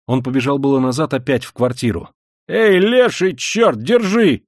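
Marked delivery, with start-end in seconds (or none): 2.5-4.4 high, loud, slowed yell down the stairs; wild yell. A narrator's reading. Someone downstairs yells to catch a runner. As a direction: bellow after someone fleeing. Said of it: "С криком вырвался кто-то внизу".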